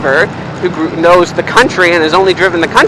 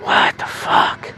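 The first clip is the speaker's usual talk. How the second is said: slow breathless under-the-breath curse; stunned, half to herself; disbelieving mutter; 7 dB quieter, much slower than usual. Real, not acted. Muttered after near accident.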